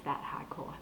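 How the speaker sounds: HARDCORE said softly as Bostonian "hahdcoah"; Boston accent. Shown as a demonstration. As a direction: drop the Rs in HARDCORE, Boston style, under your breath. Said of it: "That Boston accent".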